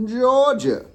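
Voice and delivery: high, loud mock Georgia drawl; Southern drawl. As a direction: push the Georgia accent up high. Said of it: "How do they talk down in Georgia".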